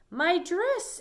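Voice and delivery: high, loud cry of dismay; dismayed exclamation. Acted. Mrs. Rogers seeing her dress full of holes, crying out. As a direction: wail it like a ruined treasure. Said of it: "exclaimed Missus Rogers".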